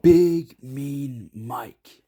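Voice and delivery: big, slow, booming title read; dramatic title. A narrator's reading. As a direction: announce the title slowly and grandly.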